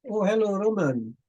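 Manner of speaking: ordinary talk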